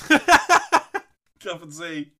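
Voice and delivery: slow, high, cracking up on the words; laughing. Real, not acted. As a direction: answer through loud laughter. Answers absurd choice through laughter.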